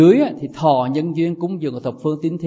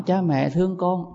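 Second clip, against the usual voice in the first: usual speaking voice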